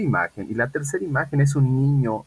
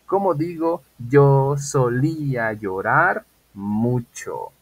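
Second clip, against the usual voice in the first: slow drill question; 3 semitones higher, much slower than usual. Shown as a demonstration. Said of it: "teacher drilling the sentence".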